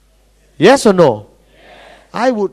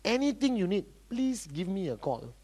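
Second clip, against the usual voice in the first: soft courteous offer, dropping away; low-key, softly spoken politeness; polite encouragement; 3 semitones lower, 11 dB quieter than usual. Acted. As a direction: offer help quietly and mildly. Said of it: "What should I say?".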